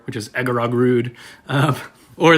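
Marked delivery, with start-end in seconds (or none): 0.3-1.1 Egarogrud spoken as garage door reversed; backwards talk. Shown as a demonstration. Showing friends' favorite backwards word, Egarogrud said as reversed speech. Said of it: "Maybe I'll talk backwards".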